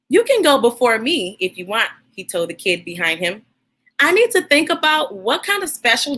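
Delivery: put-on warm, steady reading to children; story read aloud. Host reading Jabari Jumps to young viewers, gentle storytelling. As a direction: read the picture book to the kids. Reads picture book aloud.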